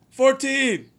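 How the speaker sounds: a number shouted out high and loud; calling out. Retold or imitated. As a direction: call the number across the table. Number called across the table.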